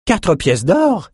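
indignant bidder balking at the price. A narrator's reading. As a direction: scoff at the price. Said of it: "s'indigna une enchérisseuse".

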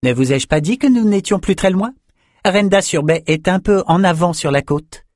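high, proud scholar's voice; high-pitched pride. A narrator's reading. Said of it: "l'air très fier".

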